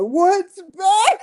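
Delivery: loud, high mock yell; mock shout. Imitating a staff member, loud mock shout. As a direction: bark the question out. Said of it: "I know somebody".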